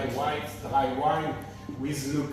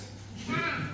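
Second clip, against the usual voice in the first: short embarrassed laugh; 12 semitones higher, 5 dB quieter than usual. Real, not acted. Laughs at own accent apology.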